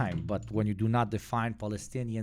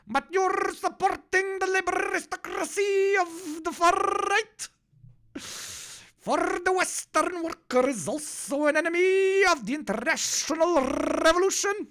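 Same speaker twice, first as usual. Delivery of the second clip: much higher, put-on preachy comrade voice; ultra leftist caricature. Streamer mocking commenters who scold him about cars, high preachy caricature. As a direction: lecture like a sanctimonious hardliner. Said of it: "ultra M L guy in my face".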